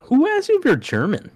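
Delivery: high-pitched disbelief; incredulous question. Real, not acted. Friend hearing people ask if he's German, incredulous.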